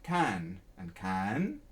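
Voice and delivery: very slow: first "can" rising, second "can" falling; slow rising then falling "can". Shown as a demonstration. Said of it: "tone rising or the tone falling".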